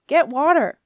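loud high command; urgent yell. A narrator's reading. Skipper yelling an order to Jenna. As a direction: order her to fetch water, loudly. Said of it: "yelled Snorri".

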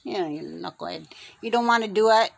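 ordinary talk, nothing marked

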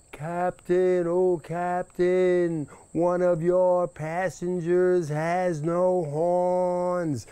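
high, slow calling across the water; drawn-out call. Acted. Rabbit hailing the captain from the hill, slow and high. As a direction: stretch it out like hailing a far boat.

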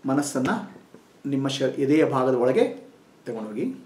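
soft, slow meditation voice with long pauses; guided meditation. Acted. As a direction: guide them inward, gently and unhurried.

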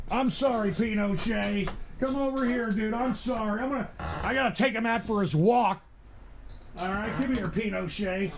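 soft, off-mic talk; soothing apology to a dog. Real, not acted. Owner apologising to his dog Pinochet, soft and soothing. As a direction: drop the volume and coax the dog over.

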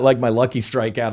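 ordinary talk, nothing marked

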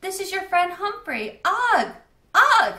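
high, loud calling across the lake; calling out with all his might. A narrator's reading. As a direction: shout for a lost friend. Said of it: "squeaked with all my might".

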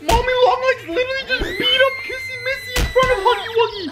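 high-pitched, squealing disbelief; shocked outburst. Real, not acted. Viewer reporting Kissy Missy beaten up, shocked outburst.

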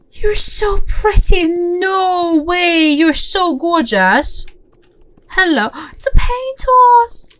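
slow, high, gushing excitement; real delighted squeal. Real, not acted. Player spotting a gorgeous paint horse, high delighted squeal. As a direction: gush loudly and high, stretching every word.

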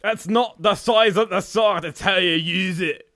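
hammed-up retort. Recorded take of mock fight.

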